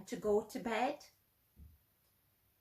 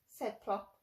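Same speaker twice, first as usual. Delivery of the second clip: ordinary delivery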